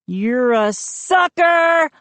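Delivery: slow, high gloating exclamation; exclaimed. A narrator's reading. Slow triumphant jeer. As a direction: Joe springs his trick on Mindy, gloating. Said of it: "I exclaimed".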